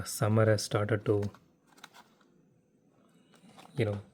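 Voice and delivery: ordinary talk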